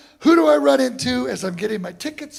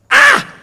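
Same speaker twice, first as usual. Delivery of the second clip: angry yell; 12 dB louder than usual. Retold or imitated. Cuban band punishing clave error.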